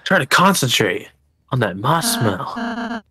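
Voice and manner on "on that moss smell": hissing snake. Pretend. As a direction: draw out the s like a snake. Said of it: "why are you doing a snake impression?".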